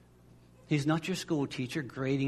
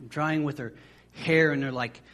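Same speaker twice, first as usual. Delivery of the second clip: usual speaking voice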